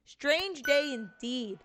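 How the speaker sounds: high, very slow put-on voice; drawn-out character echo. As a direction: stretch it out in an exaggerated character voice. Second character echoes the phrase, drawn out.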